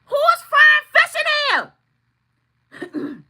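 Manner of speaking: high, loud, outraged yell; furious shout. Retold or imitated. Walking in on the mother-in-law, shouting high and loud. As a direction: blast it like you just walked into a fish fry. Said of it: "I said".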